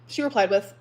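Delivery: ordinary talk, nothing marked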